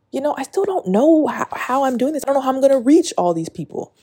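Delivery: high, fast, pleading; anxious outpouring. Real, not acted. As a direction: spill out the worry. Anxious, rising, fast confession.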